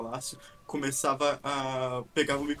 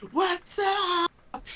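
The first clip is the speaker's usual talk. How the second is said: mock crowd shout; 6 dB louder than usual. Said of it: "todo mundo no final gritava".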